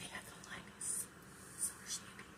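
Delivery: whisper. Real, not acted. Reveals his favorite beer.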